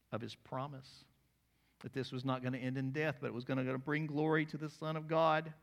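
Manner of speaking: ordinary delivery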